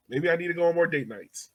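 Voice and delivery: normal talking voice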